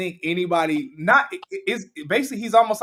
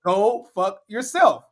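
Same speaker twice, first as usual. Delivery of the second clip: imitating a slow, pointed retort, pitch raised; slow, deliberate repeat; 4 semitones higher, slower than usual. Co-host acts out the billionaire repeating his insult, slow and deliberate. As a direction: draw out each word as if making it clear. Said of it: "let me say it again".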